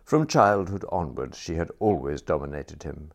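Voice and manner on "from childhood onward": plain reading voice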